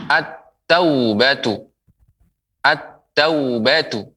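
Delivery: "at-taubatu" slowly, lam merged into a doubled t; teacher's slow model of "at-taubatu" with held t; assimilated lam. Shown as a demonstration. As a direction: stretch "at-taubatu" syllable by syllable, no l. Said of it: "karena dilebur".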